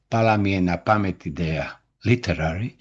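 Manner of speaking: ordinary talk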